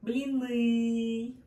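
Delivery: Блины with the stress on the last syllable; Блины, блиНЫ, stress at the end. Shown as a demonstration. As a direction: land firmly on the final ны of Блины. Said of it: "stress is at the end".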